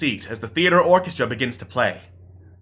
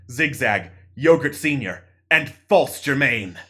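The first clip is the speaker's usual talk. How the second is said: ordinary talk, nothing marked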